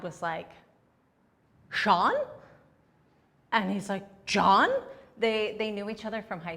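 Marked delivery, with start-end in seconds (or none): 1.7-2.1 loud, startled questioning name; imitating a stunned dad; surprised recognition. Dad recognises the person detaining her. 4.3-4.7 loud name with a questioning lift; mock-startled loud question; surprised recognition. Detainer recognises her dad in return.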